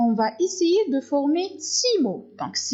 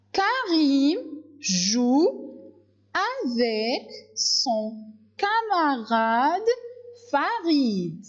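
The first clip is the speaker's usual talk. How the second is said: "Karim" très lent, syllabe par syllabe; lecture syllabée; 3 semitones higher, slower than usual. Shown as a demonstration. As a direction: lis en détachant chaque syllabe. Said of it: "syllabe par syllabe". (lecture lente syllabe par syllabe)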